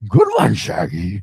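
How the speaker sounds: low cartoon-dog voice; rumbly rising dog catchphrase; Scooby-Doo. Host as cartoon dog to his buddy, low impression. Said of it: "To Scooby-Doo".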